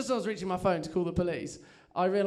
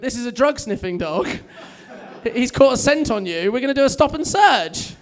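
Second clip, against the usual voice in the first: loud, raised official warning; dog owner's announcement; 5 semitones higher, 8 dB louder than usual. Acted. Drug-dog handler to passenger on the train, loud official announcement. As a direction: announce it firmly, building higher and louder.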